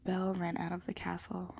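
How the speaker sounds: plain reading voice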